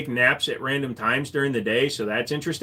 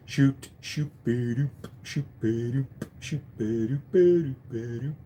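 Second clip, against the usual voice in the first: mouth-made gun action noises; short popped "ba-doop" bursts in a put-on voice; imitated gunfire; 5 dB quieter than usual. Shown as a demonstration. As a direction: mimic the soft cycling of a gun. Mimics a gentle-shooting gun.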